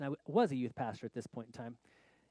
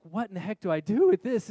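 loud, higher-pitched mock exasperation; exasperated dilemma; 4 semitones higher, 12 dB louder than usual. Youth pastor torn over the hitchhiker, exasperated. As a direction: blurt it out at a loss. Acting out his inner dilemma.

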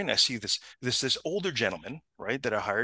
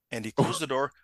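usual speaking voice

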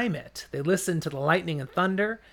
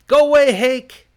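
loud angry shout through door; 9 semitones higher, 12 dB louder than usual. Acted. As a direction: yell it to send him away. Rand yells at door.

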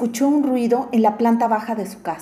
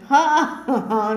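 mocking laugh; 3 semitones higher than usual. A narrator's reading. Rich man laughs at thief.

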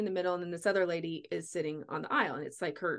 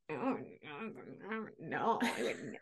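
quiet, slow mock refusal; squeamish refuser; 3 semitones lower, 6 dB quieter, slower than usual. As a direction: imitate someone shrinking from the idea, hushed. Imitating squeamish people refusing.

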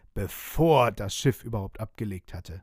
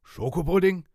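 louder, higher puzzled "Schokopudding"; puzzled pirate; 6 semitones higher, 5 dB louder than usual. Acted. Pirate captain hears of pudding, surprised and louder. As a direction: ask it as if the word is foreign.

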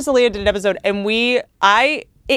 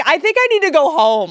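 mock Leah Remini; 7 semitones higher than usual. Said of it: "Leah Remini was like".

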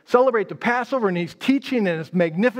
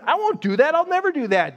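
high-pitched, indignant denial; acted-out earnest objection; insistent protest; 7 semitones higher than usual. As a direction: protest with pitch lifted, refusing to believe it. Peter protests Jesus' prediction.

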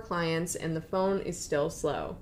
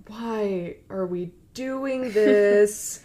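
very slow, dragged-out mock complaint; exasperated; 3 dB louder, much slower than usual. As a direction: stretch each word in hammed-up dismay. Exasperated friend quoted.

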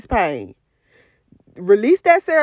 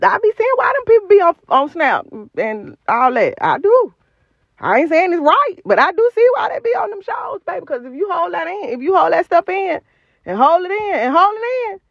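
rising excitement; 6 semitones higher than usual. Real, not acted. Worked up about holding pain in.

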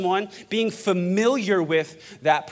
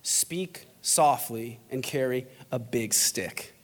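slow, low, hushed quotation; speaking softly; 4 semitones lower, 6 dB quieter, slower than usual. Acted. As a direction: lower the voice and space out the words. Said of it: "he speaks softly".

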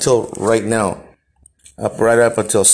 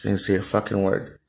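usual speaking voice

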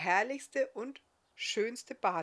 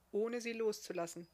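ordinary delivery

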